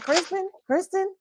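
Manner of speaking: calling out. Retold or imitated. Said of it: "That's why I was like".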